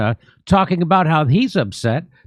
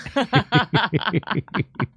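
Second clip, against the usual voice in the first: a real laugh, higher and softer, trailing off; 6 semitones higher, 5 dB quieter than usual. Real, not acted. Host laughing at his own Pfizer ad joke.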